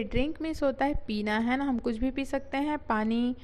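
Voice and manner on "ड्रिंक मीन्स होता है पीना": normal talking voice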